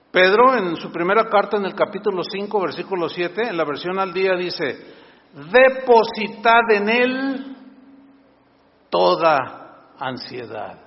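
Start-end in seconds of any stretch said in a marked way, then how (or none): 5.3-10.8 slow, solemn verse read aloud; preacher quoting Peter; scripture reading. Acted. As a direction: read the verse slowly and weightily. Pastor reads Bible verse.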